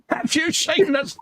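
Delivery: very high, silly tank-crewman voice; happy little figure. Pretend. Host voices the grinning model figure, high silly voice. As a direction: squeak it out as the happy little dude. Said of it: "It's like".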